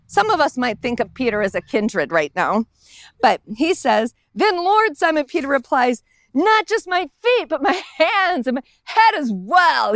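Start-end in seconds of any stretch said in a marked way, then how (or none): 4.3-5.0 higher, eager plea to Jesus; eager Peter. Retold or imitated. 6.4-9.9 higher, eager plea to Jesus; eager Peter. Retold or imitated.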